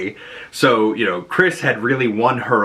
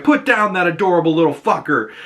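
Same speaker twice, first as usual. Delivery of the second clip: tough guy; 7 semitones higher than usual. Retold or imitated. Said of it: "and he's like".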